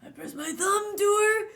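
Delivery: lightly hammed-up high British villain voice; Lord Voldemort. Streamer reading the book as a requested villain, hammed-up British voice. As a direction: attempt a voiceless British villain, breaking into laughter. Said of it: "Lord Voldemort".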